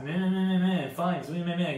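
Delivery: high, whiny "meh meh" nagging; sneering mock whine of a comment troll; mocking commenter. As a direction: mimic a whiny know-it-all commenter. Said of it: "someone jumps in the comments like".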